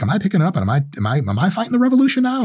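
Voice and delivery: put-on high, unsure teenage voice; hesitant young boy; doubting teenager. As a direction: ask it like a scared kid. Imagined teenage kid hesitating.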